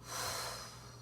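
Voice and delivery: long soft breathy exhale; exhale sigh. Shown as a demonstration. Teacher showing the class a long exhale sigh. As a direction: breathe out audibly to show the release.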